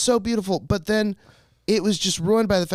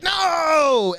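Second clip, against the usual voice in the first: mock horrified shout; 10 semitones higher, 3 dB louder than usual. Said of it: "And we're like".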